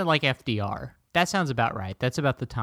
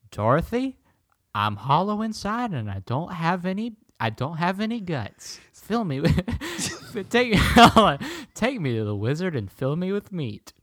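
lightly raised, breaking into laughs; hollow tin man, pitched up with giggles; 6 semitones higher than usual. Acted. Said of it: "Do Metal Mario".

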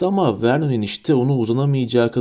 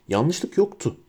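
higher-pitched indignant retort; mildly angry; 5 semitones higher, 3 dB quieter, slower than usual. A narrator's reading. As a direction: contradict crossly, without loudness. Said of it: "Harry öfkeyle".

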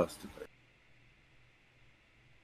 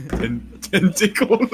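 laughing; 12 semitones higher, much slower than usual. Real, not acted. Laughing at the octopus joke.

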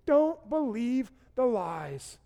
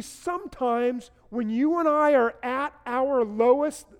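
ordinary talk

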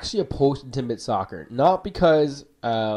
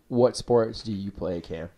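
ordinary talk, nothing marked

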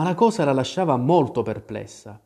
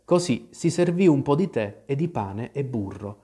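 ordinary delivery, nothing marked

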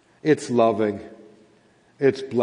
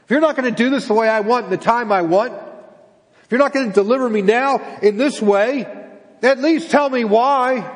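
indignant demand; 7 semitones higher, 4 dB louder than usual. Acted. Believer turning the tables on God, indignant demand. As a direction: demand it insistently, pitch raised.

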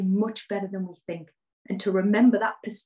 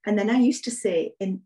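normal talking voice

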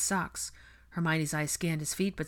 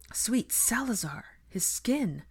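drawn-out, higher gasp of shock; shocked exclamation; 6 semitones higher, slower than usual. A narrator's reading. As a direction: exclaim in alarm at the touch. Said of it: "Pansy exclaimed".

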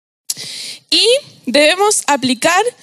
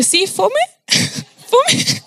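laughing mock agreement; 8 semitones higher, much slower than usual. Mocking reply to the child.

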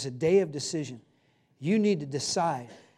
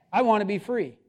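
normal talking voice

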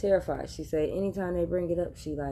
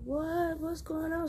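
high startled question; high surprised auntie asking what happened; 14 semitones higher than usual. Retold or imitated. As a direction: sound suddenly alarmed. Aunt surprised at crying niece.

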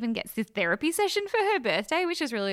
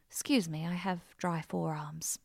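lower, soft deadpan mutter; deadpan complaint; 4 semitones lower, 7 dB quieter than usual. Retold or imitated. Fleabag to the therapist, lower soft deadpan. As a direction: murmur it dryly. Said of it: "she's like".

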